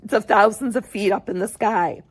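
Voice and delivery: usual speaking voice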